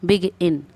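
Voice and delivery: "बिग इन" in two parts, बिग stressed; teacher's syllable demo, बिग then इन; split, first syllable stressed. Shown as a demonstration. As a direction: break after बिग, lighter on इन. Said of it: "गिन पे कम दे रहे हैं".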